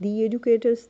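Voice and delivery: THE drawn out as "thee" before educators; vowel-sound article. Shown as a demonstration. Teacher gives vowel examples, THE as "thee". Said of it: "इनके साथ भी आप "the" use".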